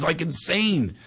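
ordinary talk, nothing marked

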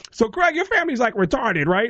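high-pitched, blunt clueless questioner; Tracy Morgan. Retold or imitated. Comedian asking about the comic's family, high clueless voice. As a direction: ask it high and bluntly oblivious. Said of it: "Tracy is kind of in my area".

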